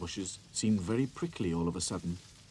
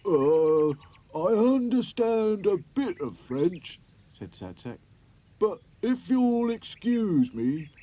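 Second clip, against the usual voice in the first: higher, louder, slow and polite; polite toy voice; 11 semitones higher, 10 dB louder, much slower than usual. A narrator's reading. Sad Sack politely answering the French doll, higher and slow. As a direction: excuse yourself kindly.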